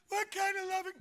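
high, squeaky mock-outraged voice; mocking the world. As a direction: ham up a scoffer flailing his arms. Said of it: "they flail their arms and go".

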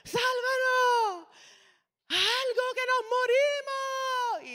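high, slow terrified wail; panicked cry. Retold or imitated. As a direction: cry out as if drowning. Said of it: "empezaron a gritar".

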